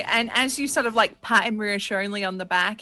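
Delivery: ordinary delivery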